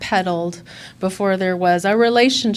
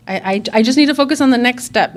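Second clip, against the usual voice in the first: fast, loud, pressing insistence; a burst of urgency, talking fast; urgent; 5 semitones higher, 7 dB louder, much faster than usual. Real, not acted. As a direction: push the words out in a rush. Urgent self-talk, loud and fast.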